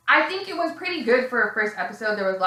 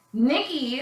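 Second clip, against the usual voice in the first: normal talking voice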